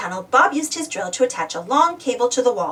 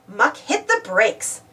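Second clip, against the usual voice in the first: ordinary delivery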